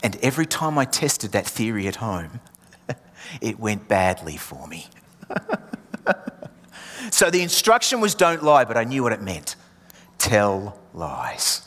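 usual speaking voice

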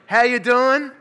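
big, loud, high hello to the room; booming good morning to the congregation; loud greeting. Real, not acted. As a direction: call out a cheery welcome. Pastor greeting congregation loudly.

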